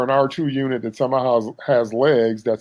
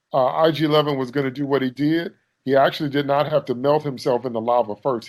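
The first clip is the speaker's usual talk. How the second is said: usual speaking voice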